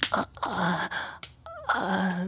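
ordinary talk, nothing marked

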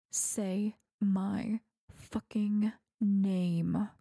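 very slow, voiced, each word stretched; drawn-out command. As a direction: drag the demand out word by word. Same demand, now voiced and drawn out.

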